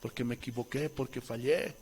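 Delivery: soft humble apology. Retold or imitated. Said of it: "Y les digo".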